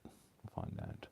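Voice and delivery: "We'll find out" breathed low and slow in a hush; ominous whisper. Pretend. Crafter teasing viewers about the crackle result, whispered slowly. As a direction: whisper it like a spooky promise.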